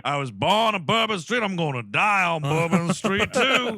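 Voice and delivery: loud, hammed-up New Orleans local accent; over-the-top regional accent, punched out loud; specific local accent. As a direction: boast in an exaggerated mock accent. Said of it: "If you're speaking with this incredibly, uh, specific accent".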